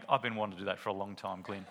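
fast, gloating with a laugh; self-satisfied gloat. Retold or imitated. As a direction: rattle it off pleased with yourself, chuckling. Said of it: "Jez is standing there really self-satisfied".

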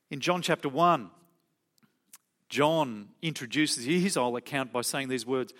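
usual speaking voice

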